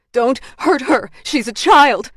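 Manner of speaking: high, loud protest; desperate shout. Acted. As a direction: cry out to protect a child. Bound woman pleads for the child.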